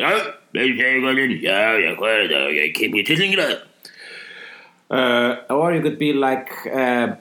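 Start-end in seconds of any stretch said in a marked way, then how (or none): 0.0-3.6 lightly voiced mock Icelandic type; a hint of a caricatured local; imitating a type. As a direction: rattle off yeah yeah in a put-on voice. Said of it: "the one I was mentioning this week".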